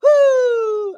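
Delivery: winded, exhausted exhale; breathless high whew. Real, not acted. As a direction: blow out a long tired whew. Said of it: "Let me catch my breath".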